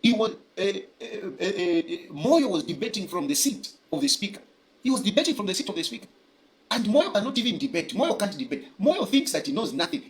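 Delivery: ordinary talk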